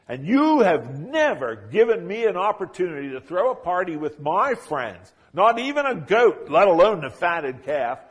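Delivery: elder son shouting at his father; angry outburst. Acted. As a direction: let the grievance boil over. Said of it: "the elder son loses it".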